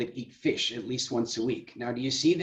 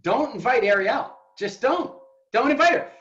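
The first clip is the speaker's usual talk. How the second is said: loud, high, playful warning; hammed-up plea not to invite her; mock warning; 8 semitones higher, 4 dB louder than usual. Coach teasing his audience with a loud mock warning. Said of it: "I'm totally exaggerating".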